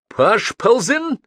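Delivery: high, slow, loud cry; sudden shout. A narrator's reading. Madman suddenly shouting at the passengers, loud cry. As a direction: shout it out with drawn-out syllables. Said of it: "крикнул вдруг Бабст".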